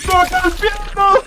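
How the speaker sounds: squeaky mock voice. Streamer mimicking a game sound in a squeaky voice. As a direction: squeak the line like a cartoon.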